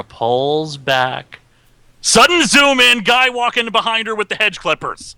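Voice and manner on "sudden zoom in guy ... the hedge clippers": sudden rise in pitch for the zoom; excited jolt. Retold or imitated. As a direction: jump up as the scare hits.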